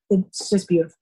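breathy whispered aside. Real, not acted. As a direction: whisper it, moved. Touched after describing honor.

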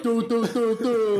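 high mock humming impression; high silly vocal impression; bad impersonation. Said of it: "the person's like".

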